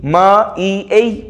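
म ई ए drawn out very slowly, letter by letter; spelled out slowly. Shown as a demonstration. Teacher slowly sounds out मई letter by letter. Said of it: "मई".

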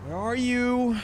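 high sing-song call across the map. Real, not acted. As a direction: call out for a lost friend. Calling out for missing teammate.

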